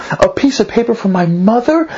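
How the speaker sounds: high, incredulous retold question; going crazy. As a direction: act out astonishment, pitch lifted to the end. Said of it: "he's going crazy".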